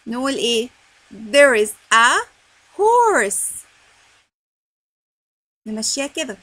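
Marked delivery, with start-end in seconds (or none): 1.1-3.5 slow, loud, word-by-word reading; read-along model. Shown as a demonstration. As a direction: lead young readers through it, drawing out each word. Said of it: "let's read together".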